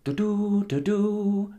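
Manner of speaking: high tooting trumpet sound; trumpet call. Acted. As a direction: toot it like a tiny horn. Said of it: "Dwerg called into his trumpet".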